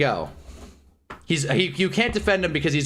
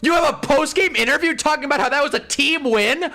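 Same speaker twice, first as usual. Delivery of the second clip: furious rant; 11 semitones higher than usual. Real, not acted. Lakers fan raging at the Thunder's postgame, shouting high. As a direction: yell at the nerve of it all.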